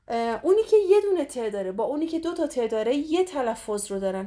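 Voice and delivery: usual speaking voice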